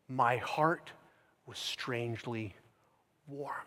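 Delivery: soft, low, slowly released words; hushed testimony. Retold or imitated. Preacher quotes John Wesley on his conversion, hushed. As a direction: quote it quietly, letting the words fade.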